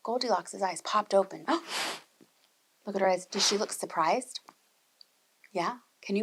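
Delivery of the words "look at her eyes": quick, hushed, wide-eyed surprise; mock surprise. Teacher shows the children a surprised reaction, quick hushed. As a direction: gasp and rush it out.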